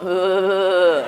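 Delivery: loud, stretched "ugh"; mock guttural call; imitated animal groan. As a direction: mimic the noise for laughs. Mimics the noise once more, joking.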